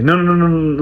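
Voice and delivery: mock irritated string of refusals; pissed-off interruption. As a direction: imitate an angry brother cutting someone off. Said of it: "really pissed".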